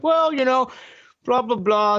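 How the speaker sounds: high, sing-song mock of a friend. Mocking a friend who blames government handouts, high voice. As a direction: parody the friend's tired argument up high. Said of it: "my one friend was like".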